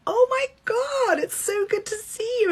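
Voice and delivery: mock-thrilled, high-pitched hello; gushing greeting. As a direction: play along with exaggerated delight. Celebrity plays along with gushing greeting.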